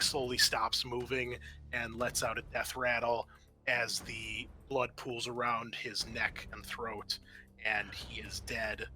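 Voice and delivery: normal talking voice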